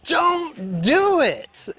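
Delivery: high, drawn-out mock scream. Every cell screaming at a highliner to stop, high mock scream. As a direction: stretch it out high, like screaming in protest. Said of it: "was screaming".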